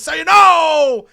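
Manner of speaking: over-the-top wrestling promo boast; Scott Steiner impression. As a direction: belt it out like a roided-up heel on the mic. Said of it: "And Scott Steiner says".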